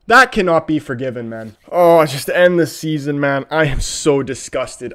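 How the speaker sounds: usual speaking voice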